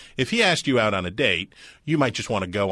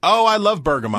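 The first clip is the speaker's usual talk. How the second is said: gushing; 12 semitones higher, 7 dB louder than usual. Acted. Teasing gushing over bergamot.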